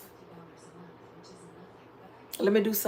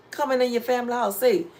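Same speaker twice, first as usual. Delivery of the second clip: ordinary talk, nothing marked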